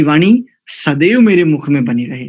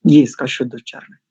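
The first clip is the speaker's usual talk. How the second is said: ordinary delivery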